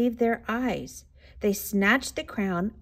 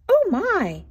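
put-on high, loud cry of an old woman; startled cry; 10 semitones higher, 5 dB louder than usual. A narrator's reading. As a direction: exclaim high in storybook shock. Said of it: "cried the tiny old woman".

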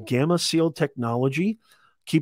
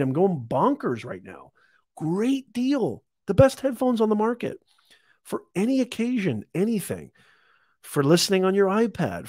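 excited; 6 semitones higher than usual. Real, not acted. Excited sales pitch over deal.

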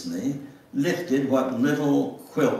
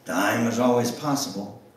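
ordinary delivery

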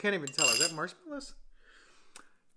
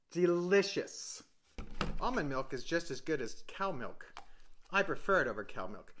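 ordinary talk

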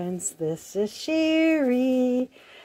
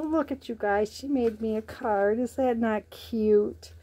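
normal talking voice

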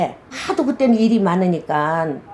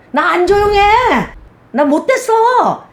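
playful protest; 9 semitones higher, 4 dB louder than usual. Real, not acted. Denies being quiet to clients.

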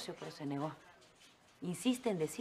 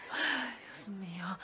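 whisper; 6 dB quieter, slower than usual. Acted. Mother overwhelmed by daughter's news.

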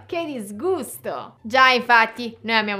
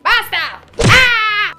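much louder, higher scream; screaming exit; 9 semitones higher, 10 dB louder, much slower than usual. Acted. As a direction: yell as you're thrown out. Jealousy thrown out screaming.

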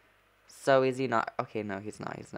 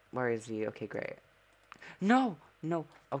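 ordinary delivery

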